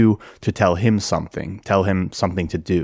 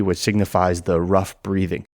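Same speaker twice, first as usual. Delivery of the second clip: ordinary talk, nothing marked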